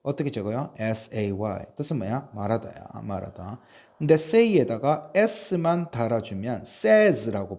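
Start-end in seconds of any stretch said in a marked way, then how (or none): none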